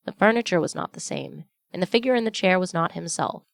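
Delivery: ordinary delivery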